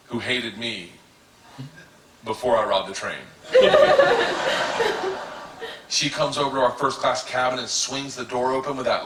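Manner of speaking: usual speaking voice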